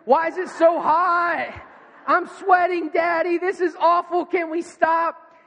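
high, loud, drawn-out complaining yell. Retold or imitated. Dad imitating his daughter's complaints on a run, yelling out. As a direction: holler it like a dramatic overheated child. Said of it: "she starts yelling out".

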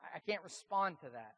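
shut down, stumbling. Retold or imitated. Pastor with no comeback to the prime minister, mock stumped. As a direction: stammer it quietly, as if stuck for an answer. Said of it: "it just shut the pastor down".

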